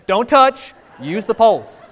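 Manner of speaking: high, loud don't touch; firm warning. Acted. Preacher calls out the rule to his congregation, loud and high. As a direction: call out the rule like a stern parent.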